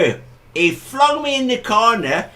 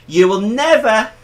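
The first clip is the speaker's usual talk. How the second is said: normal talking voice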